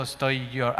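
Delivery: ordinary delivery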